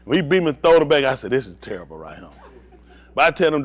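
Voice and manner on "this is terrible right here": low muttered laugh. Retold or imitated. Muttered quote while laughing.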